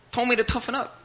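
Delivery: normal talking voice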